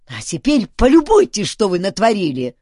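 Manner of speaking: cross, accusing outburst; angry shout. A narrator's reading. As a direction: scold them crossly, starting high. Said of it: "сердито крикнул Дункан".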